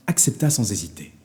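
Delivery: ordinary delivery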